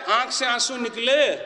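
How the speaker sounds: normal talking voice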